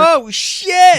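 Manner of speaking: sudden high loud yell; shout. Real, not acted. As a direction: blurt it out in shock. Sudden outburst at friend.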